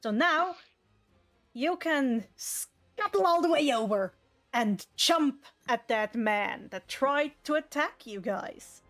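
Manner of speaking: ordinary talk, nothing marked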